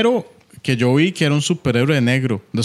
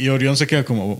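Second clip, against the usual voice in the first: usual speaking voice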